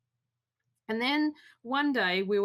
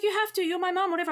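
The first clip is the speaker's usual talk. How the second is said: bratty daughter; 8 semitones higher, faster than usual. Retold or imitated. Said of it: "And she's like".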